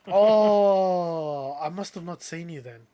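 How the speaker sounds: long, loud drawn-out oh, then backing down; imitating someone caught out, hammed-up oh; mock realization. Retold climb-down of the caught driver.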